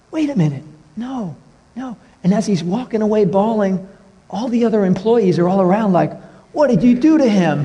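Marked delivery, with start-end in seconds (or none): 0.1-2.0 high-pitched mock dismay, trailing softer; retold concerned protest. Retold protest to crying clerk. 6.5-7.7 high, shocked employee outcry, acted; mock accusation.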